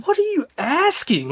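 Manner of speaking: high, drawn-out baffled question; so confused. Retold or imitated. Hunter retelling his reply to his buddy's nonsense, baffled. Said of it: "I was so confused".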